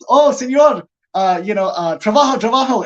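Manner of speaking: mock Spanish. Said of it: "I'm like".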